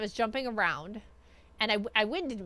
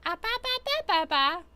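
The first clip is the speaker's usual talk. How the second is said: put-on squeaky high baby babble; 6 semitones higher than usual. Mimicking model's babbling reply.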